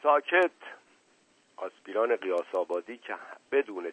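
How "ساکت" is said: high, sharp call for silence; one-word order, mildly shouted; shouted command. A narrator's reading. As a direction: cut them off sharply. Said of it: "باز فریاد نایب بلند شد".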